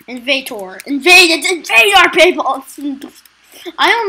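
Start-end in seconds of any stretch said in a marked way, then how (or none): 0.8-2.5 louder, exasperated: Invader; exasperated loud correction. Real, not acted. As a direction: shout the correction at viewers.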